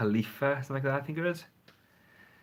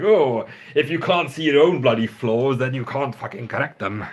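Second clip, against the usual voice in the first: much louder hammed-up lecturing, swearing in his true accent; mock preachy rant; 9 dB louder than usual. Vlogger mocks himself lecturing viewers about flaws, mock shouting. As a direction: play the preachy guru, half-joking. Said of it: "I hope I'm not sounding too preachy here".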